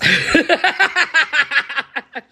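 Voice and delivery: bright burst of high laughter. Real, not acted. Laughs at a viewer's comment.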